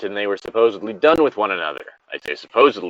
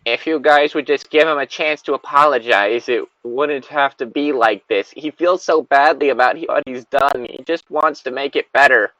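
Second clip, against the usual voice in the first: guilt-tripping friend; 4 semitones higher, 3 dB louder than usual. Retold or imitated. Miss Piggy quoted guilting friends.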